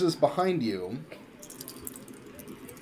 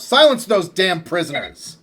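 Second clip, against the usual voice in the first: loud, higher-pitched guard's shout; yelled order; 6 semitones higher, 9 dB louder than usual. Acted. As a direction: yell it out as an angry command. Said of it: "he yells out".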